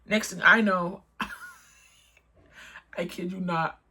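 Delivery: ordinary delivery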